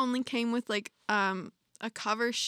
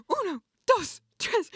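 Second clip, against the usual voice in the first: higher, louder mock-aggressive counting in Spanish; 9 semitones higher, 4 dB louder than usual. Imitating the maid counting blankets, mock-aggressive and louder. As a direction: bark out the count like an impatient maid. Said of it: "very aggressively".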